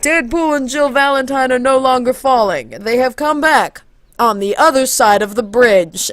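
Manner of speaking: loud, grand narrator announcement; game narrator. Retold or imitated. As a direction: proclaim it like a game's narrator. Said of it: "and the narrator goes".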